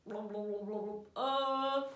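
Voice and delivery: playful babbling alien; silly alien gibberish voice. Shown as a demonstration. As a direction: wobble it like an alien, ending on a held sound. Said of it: "Can you say it in an alien voice?".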